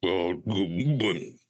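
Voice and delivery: slow, drawn-out toad imitation; mock suitor sounding like a toad. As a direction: croak it slowly like a toad. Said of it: "What are you, a toad?".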